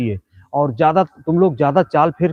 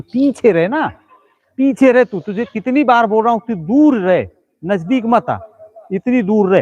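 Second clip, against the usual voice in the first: raised, higher-pitched sharp order; exasperated scolding; 5 semitones higher than usual. Real, not acted. Ordering the cameraman to keep away, exasperated scolding. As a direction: tell them off for not listening.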